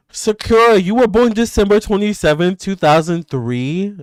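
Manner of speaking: high, loud burst of disbelief; real excitement at a shared birthday; excited discovery. Real, not acted. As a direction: call out the news, thrilled.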